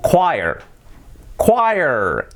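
choir as KWAI-er; pronunciación correcta. Shown as a demonstration. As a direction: say "choir" with kw and falling end. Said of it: "No, se dice". (choir bien dicho, 'kuáier')

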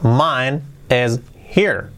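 very slow, separated words; careful word-by-word dictation; slow model. Shown as a demonstration. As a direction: leave a gap after each word. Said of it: "Dicho rápido".

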